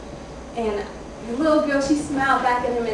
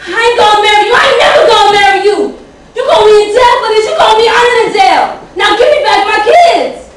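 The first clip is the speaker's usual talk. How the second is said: furious yell; 10 semitones higher, 14 dB louder, faster than usual. Acted. Mother screaming back at him.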